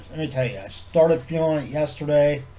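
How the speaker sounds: normal talking voice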